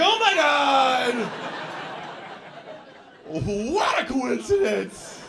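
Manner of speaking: loud, high, drawn-out amazement; astonished shout. Acted. Comic phoning Trevor with the news, astonished. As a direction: shout in hammed-up delight at a coincidence.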